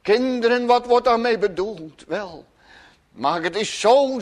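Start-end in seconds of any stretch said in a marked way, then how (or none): none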